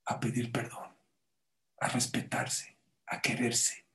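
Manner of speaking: soft, unvoiced closing words, slow on the middle one; a quiet earnest hush; hushed moral. Real, not acted. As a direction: whisper the lesson softly.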